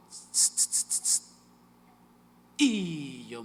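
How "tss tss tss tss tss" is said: hissing tss. Retold or imitated. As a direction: hiss tss tss like a tap on the shoulder. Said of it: "y me dijo".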